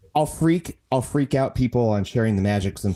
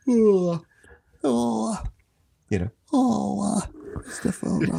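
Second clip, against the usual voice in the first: high yawning oh, fading to a breathy name; yawning Pooh; 7 semitones higher, slower than usual. Shown as a demonstration. Sleepy yawn-voiced bear. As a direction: guest showing the yawn trick, high then breathy. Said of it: "It's kinda like yawning".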